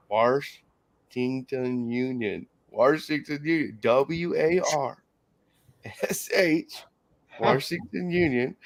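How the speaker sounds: slow spelled-out "Washington Union" with an extra R, "W A R S H"; slow, careful "Warshington Union"; sounding it out. Retold or imitated. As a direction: drag out each letter of "W A R S H" like a kid sounding it out. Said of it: "Sound it out".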